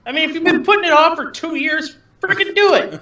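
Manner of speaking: squeaky high scolding voice; high-pitched. Real, not acted. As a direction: urge him on, way up high. Pushing him to finish.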